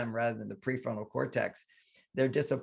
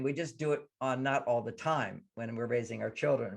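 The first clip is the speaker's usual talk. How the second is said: ordinary talk, nothing marked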